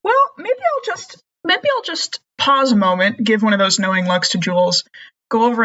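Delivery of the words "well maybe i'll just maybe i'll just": lightly voiced old lady. Maisie voiced.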